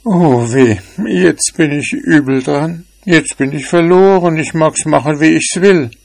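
a touch of despair in a small cat's voice; sad little cat. A narrator's reading. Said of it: "war traurig und dachte für sich".

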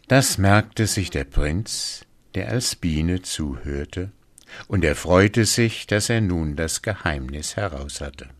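ordinary delivery

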